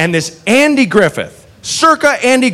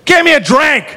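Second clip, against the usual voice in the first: put-on drunk yell; 9 semitones higher, 3 dB louder than usual. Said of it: "She goes".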